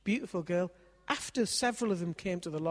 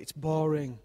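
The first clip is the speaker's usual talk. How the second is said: put-on a bored, flat teenage "It's boring"; sulky teen; 7 dB louder, slower than usual. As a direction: drag out "boring" like a fed-up daughter. Daughter voiced, sulky reply.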